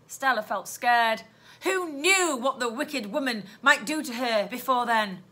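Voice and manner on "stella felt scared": ordinary delivery, nothing marked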